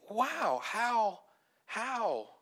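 high, drawn-out wonder; awestruck. Retold or imitated. Preacher thinking about the gospel, awestruck. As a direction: marvel on each single word.

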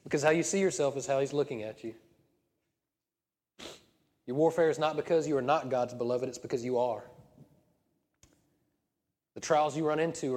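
loud, rising, passionate preaching; preaching at full force. Acted. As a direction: build to a fiery climax.

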